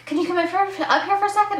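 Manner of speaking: high, loud hype-man voice; upbeat DJ. Retold or imitated. DJ inviting the fan up, high and loud. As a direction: invite her up like a stage host. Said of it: "he was like".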